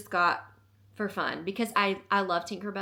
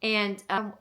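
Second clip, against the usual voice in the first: normal talking voice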